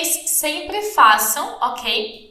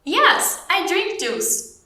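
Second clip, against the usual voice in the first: high "Yes", then slow clear answer; slow model answer; 5 semitones higher, much slower than usual. Shown as a demonstration. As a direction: answer on a high pitch, slowly. (resposta-modelo lenta e aguda)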